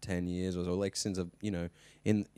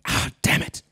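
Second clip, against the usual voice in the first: half-whispered frustrated curse; mock frustrated outburst; 11 semitones higher, 8 dB louder than usual. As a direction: swear at a blown take, forgetting the mic. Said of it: "he'd be like".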